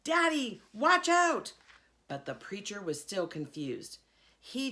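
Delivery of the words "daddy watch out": high, loud alarmed shout; scream. Acted. Girl warning her daddy of the charging dog, screaming. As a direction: yell a warning across the room. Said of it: "I screamed".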